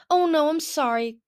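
high, loud, mortified; flustered apology. A narrator's reading. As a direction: blurt an alarmed apology. Clearsight yelps, mortified apology.

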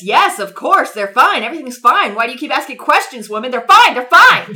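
hammed-up official insisting they're fine; dismissive official. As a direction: brush off the worried wife, getting pushier. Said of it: "The nasa says".

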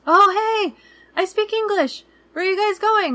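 eager local guy. Retold or imitated. Street stranger calling out to the couple, high and eager. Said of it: "this guy goes".

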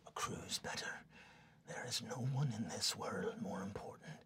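low sinister whisper; menacing whisper. Acted. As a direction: whisper the threat close.

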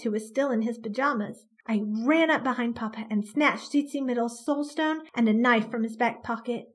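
ordinary delivery

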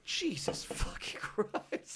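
slow breathless whisper, laughing; stunned whisper. Retold or imitated. Roommate learning guests did drugs, stunned whisper. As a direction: breathe it out in disbelief. Hearing they did DMT.

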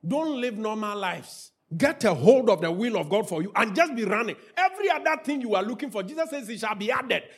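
usual speaking voice

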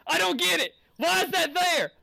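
high-pitched, worked-up griping; exasperated complaint. Real, not acted. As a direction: vent in a high, baffled protest. Venting about the Wilson.

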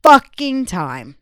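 loud, slow and drawn out; emphatic. Real, not acted. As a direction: stretch it out loudly for emphasis. Emphatic complaint about listeners.

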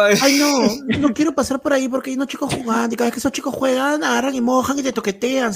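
high put-on girl voice; girly voice. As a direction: whine like a nervous girl. Girl voiced in carnival skit. (chica asustada y quejosa)

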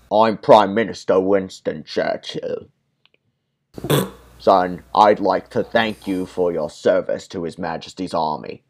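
a half-hearted Winston Churchill growl; Churchill impression. Prime Minister thanks the soldier, lightly British Churchill voice. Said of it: "I can't do Churchill".

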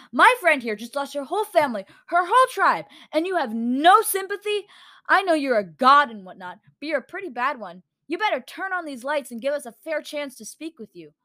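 practically screaming. A narrator's reading. Girl defending bereaved friend to a god, near screaming. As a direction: tell the god off, nearly yelling. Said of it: "Autumn was practically screaming".

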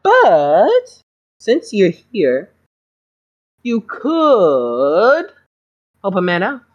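a smooth talking school doctor feigning innocence; smarmy doctor. Acted. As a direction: play the doctor as falsely sweet and wounded. GM doctor voice again.

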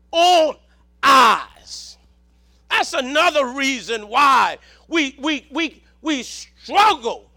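weighty sermon phrases, broken by pauses; forceful preaching. Real, not acted. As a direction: declaim the verse to the congregation. Preaches on Judges.

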